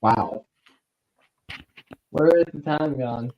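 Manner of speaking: ordinary talk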